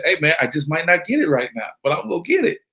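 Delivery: playful impression of a hustler pal; Jimmy Sanders. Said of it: "That's my Jimmy Sanders voice".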